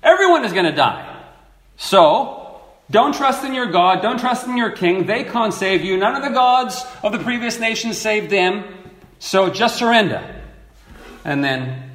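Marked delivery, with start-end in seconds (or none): 0.0-10.2 raised, higher mock taunt over the walls; taunting herald. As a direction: jeer at the city to give up. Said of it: "And the guy says".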